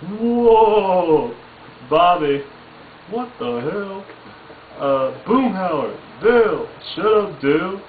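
hammed-up Hank Hill outburst; Hank Hill yelling. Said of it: "Hank Hill from King of Hill".

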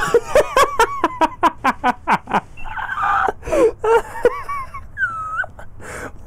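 high wheezing laugh that cracks into crying; helpless crying laughter, out of control; real tears-of-laughter shriek. Real, not acted. Hysterical laughing fit.

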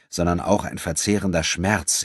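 ordinary delivery, nothing marked

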